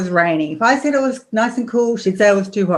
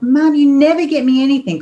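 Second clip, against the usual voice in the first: put-on high, louder whining child stressing never; whiny daughter; 7 semitones higher, 4 dB louder than usual. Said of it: "And she was like".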